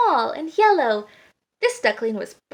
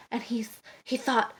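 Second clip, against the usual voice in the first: ordinary delivery, nothing marked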